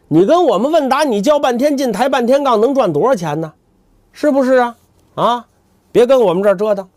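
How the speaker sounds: mocking scold. 扫地僧嘲讽.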